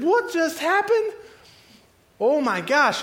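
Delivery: high, slow cry of astonishment; shocked disbelief. Acted. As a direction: exclaim in hammed-up shock. Acting the stunned pig herders.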